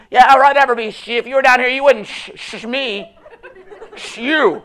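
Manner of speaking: loud, high mocking protest with laughter; shouting, laughing sarcastic complaint; exasperated retort. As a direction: argue back at God in frustration. Frustrated person arguing with God.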